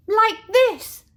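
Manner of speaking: high, loud bright answer; girl showing her parents; eager child. Acted. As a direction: call it out proudly.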